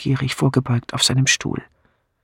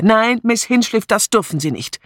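higher-pitched urgent protest, easing off; calling out; 7 semitones higher than usual. A narrator's reading. Sergeant restraining Miss Hinchliff, mildly raised urgent voice. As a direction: call out to restrain her. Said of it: "rief er".

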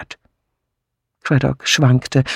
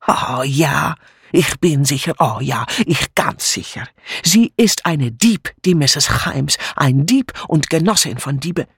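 accusing foreign maid; 2 semitones higher than usual. Acted. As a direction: denounce with scornful certainty. Mitzi accuses Mrs Haymes.

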